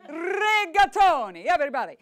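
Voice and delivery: big fiery Italian tenor, stretched out. Shown as a demonstration. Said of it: "call and response chorus about pasta".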